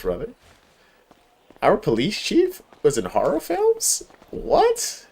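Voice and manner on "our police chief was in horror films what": high-pitched, slow disbelief leaping up on "What"; incredulous surprise. Real, not acted. Streamer learns police chief acted in horror films, incredulous. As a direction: question it in high, real astonishment. Astonished at chatter's horror film news.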